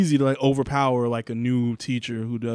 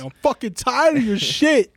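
loud, high-pitched comic outburst; mock fed-up professor; 11 semitones higher, 7 dB louder than usual. Friend voicing professor to joking student, high loud mock anger. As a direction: imitate a teacher at the end of his rope.

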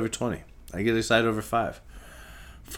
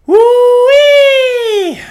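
high, very loud whoop; excited whoop; 18 dB louder than usual. Real, not acted. Host whooping to his podcast audience, excited yell. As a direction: let out a wild whoop of joy. Celebrating, cries out.

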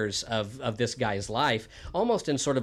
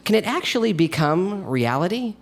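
loud, higher dramatic host intro; show host intro; 6 semitones higher, 9 dB louder than usual. Acted. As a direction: present the story like a spooky show host. Host sets up the story.

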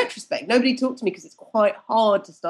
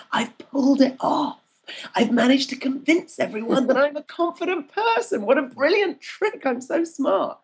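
high, thrilled self-congratulation; mock-triumphant inner voice, pitched up; acted gleeful realisation; 6 semitones higher than usual. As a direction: marvel at your own clever trick. Acts out gleeful secret realisation.

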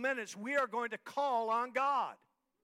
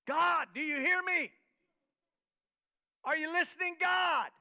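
high, loud prayer cry; insistent, raised preacher's plea; calling out to God; 6 semitones higher, 4 dB louder than usual. Acted.

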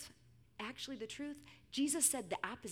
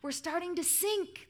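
mild storybook shout; 8 semitones higher than usual. A narrator's reading. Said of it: "the disciples shouted".

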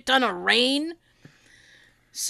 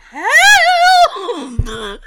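high, loud help dissolving into laughter; laughing call for help; 7 semitones higher, 10 dB louder than usual. Retold or imitated. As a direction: yell for help while laughing. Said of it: "And I went".

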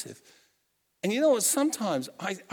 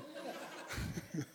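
breathy laugh; 3 semitones higher, 14 dB quieter than usual. Real, not acted. Laughing at own joke.

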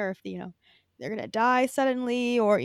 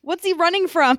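high, loud, incredulous question; amused astonishment; 7 semitones higher, 7 dB louder than usual. Real, not acted. Co-host baffled by the movie clip, loud astonishment. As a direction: ask it loudly in amused shock.

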